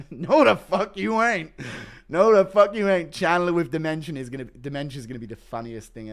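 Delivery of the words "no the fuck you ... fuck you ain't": mock furious old man. Repeating the demented father line.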